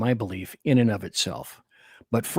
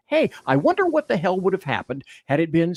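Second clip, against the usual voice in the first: worked-up, higher-pitched rhetorical question; getting excited; 6 semitones higher than usual. Real, not acted. Host posing his indignant question to listeners, getting excited. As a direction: get carried away as you pose it. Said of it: "sorry, I'm getting excited".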